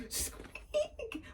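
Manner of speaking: very high breathy mouse squeak; a tiny unvoiced squeak up high. A narrator's reading. Lion lets out a frightened squeak, tiny high squeak. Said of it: "He let out a".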